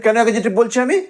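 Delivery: ordinary delivery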